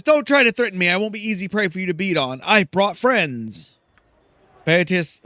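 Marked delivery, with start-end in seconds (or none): 0.0-3.8 louder, higher defiant diva; Silke. Acted. Silke refusing to be threatened, louder defiant voice. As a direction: snap back at the thugs. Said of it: "and Silke says".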